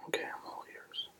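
ordinary talk

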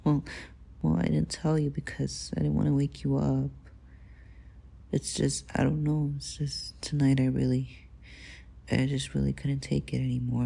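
ordinary delivery

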